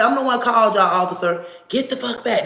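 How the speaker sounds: heated protest. Retold or imitated. Arguing with the officer who grabbed her nephew, heated. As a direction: push back hard, voice up.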